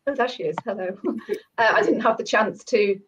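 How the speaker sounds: ordinary talk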